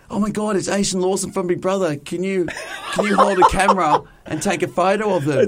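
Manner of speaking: high, gushing starstruck fan; excited fan voice. Retold or imitated. Fans asking in excited voices.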